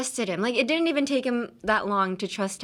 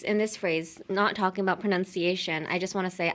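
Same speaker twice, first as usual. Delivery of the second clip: normal talking voice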